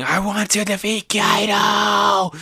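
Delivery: put-on high, slow little boy's determination; child's vow. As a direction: vow it like a small boy. Young Momo's vow.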